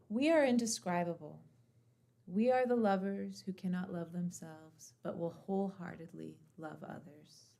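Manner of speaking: loud, declaimed poem line; poem reading. Acted. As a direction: proclaim the verse with force. Reads poem excerpt as intro.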